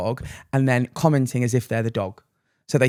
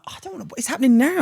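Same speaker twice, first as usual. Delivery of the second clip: impatient whine; 9 semitones higher, faster than usual. Retold or imitated. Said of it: "and I'm like".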